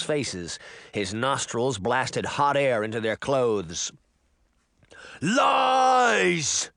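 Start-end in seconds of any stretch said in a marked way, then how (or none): 5.2-6.7 high, loud, drawn-out roar; bellowing giant. A narrator's reading. Giant rejecting Jack's accusation, bellowing. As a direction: roar the single word. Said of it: "the giant bellowed".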